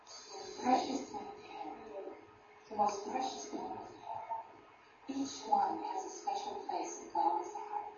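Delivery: soft, breathy whisper; whispered blessing. Real, not acted. Preacher whispers God's love to the congregation. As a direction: hush it like a prayer.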